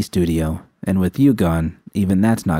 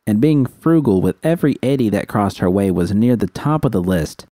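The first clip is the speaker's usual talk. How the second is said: plain reading voice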